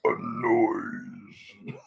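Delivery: rough strained monster growl; rasping strained mutant. Acted. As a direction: rasp it out like a brute. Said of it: "the Super Mutant impression".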